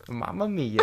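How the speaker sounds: high, soft Italian accent with a giggle; playful stock Italian exclamation; mock Italian accent. Shown as a demonstration. Joking with partner that anyone can do Italian, mock Italian accent. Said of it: "you can say anything in Italian".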